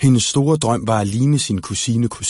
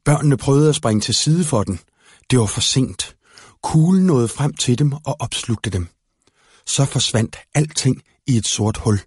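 plain reading voice

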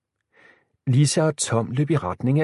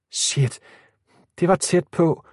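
higher, mildly shaken outburst; startled exclamation; 7 semitones higher than usual. A narrator's reading. Tom after the bullet nearly hit him, startled. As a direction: blurt it out with a touch of fright. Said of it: "udbrød han forskrækket".

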